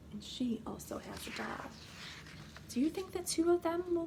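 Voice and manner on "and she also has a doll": soft hushed aside; whisper. Real, not acted. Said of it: "whispered aside".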